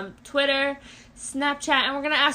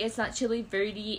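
ordinary talk

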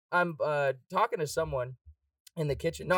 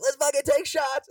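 loud, high, hyped-up call; rally the drunk girls to take shots; party shout; 11 semitones higher, 6 dB louder than usual. Retold or imitated. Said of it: "I'd be like".

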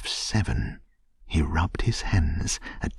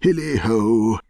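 loud, high, merry halloo; cried out; 4 semitones higher, 7 dB louder than usual. A narrator's reading. Old master skipping down from his desk, merry holler. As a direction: holler it with jovial gusto. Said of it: "cried old Fezziwig".

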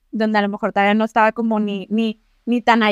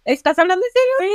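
surprised; 8 semitones higher than usual. Real, not acted. Listener reacts to ghost story.

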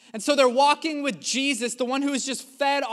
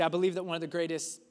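normal talking voice

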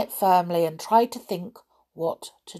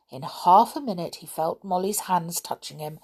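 ordinary delivery, nothing marked

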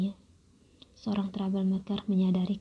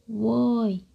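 louder shout; 3 semitones higher, 6 dB louder than usual. Retold or imitated. Angry troublemaker shouting louder at the reading narrator. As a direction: yell it out to get his attention. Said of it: "dia berteriak dengan suara yang lebih keras".

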